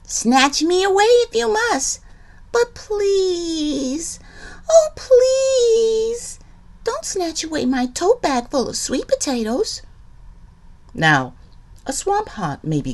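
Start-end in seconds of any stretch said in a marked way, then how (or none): 0.0-9.8 much higher, wailing mock plea; pleading cry. Liza Lou begging the haunt to spare her tote bag, high wailing plea. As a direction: beg like a frightened little girl, hamming it up. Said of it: "cried Liza Lou".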